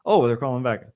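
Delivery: usual speaking voice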